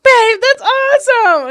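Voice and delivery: high, loud, drawn-out gushing; enthusiastic. Shown as a demonstration. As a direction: show how to cheer with over-the-top enthusiasm. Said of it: "like, enthusiasm".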